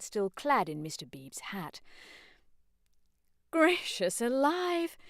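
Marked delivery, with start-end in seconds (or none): 3.5-5.0 very high, slow shocked exclamation; scandalised matron cry. Acted. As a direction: gasp it out in dismay. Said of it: "cried Missus Honeychurch".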